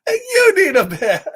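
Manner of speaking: hammed-up pigeon pointing the finger; mock mad pigeon. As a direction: fire it back at them in jest. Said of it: "And then he gets mad".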